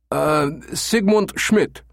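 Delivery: put-on high, slow, hesitant German accent; stall, then offer it in a high German accent; weakly invented, German accent. A narrator's reading. Caller makes up his own name, hesitant German accent. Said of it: "Becker invented weakly".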